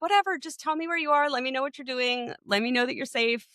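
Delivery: high, casual whatever to the son; breezy parent. Retold or imitated. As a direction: toss it off lightly, then settle. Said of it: "We're like".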